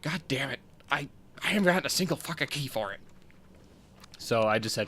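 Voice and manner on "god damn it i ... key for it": fast exasperated griping; frustrated rant. Retold or imitated. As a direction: vent like you got snubbed. Frustrated quote about beta key.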